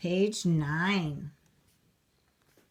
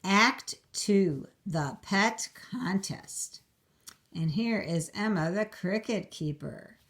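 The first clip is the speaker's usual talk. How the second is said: ordinary delivery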